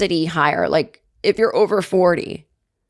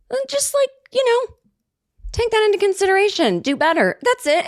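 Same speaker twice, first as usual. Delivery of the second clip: high pleading. Real, not acted. Pleading with listeners, pitch shoots up.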